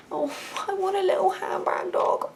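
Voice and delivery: high, put-on squeal of longing; mock cutesy pining. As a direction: coo for a tiny dog, way up high. Mock pining for a small dog.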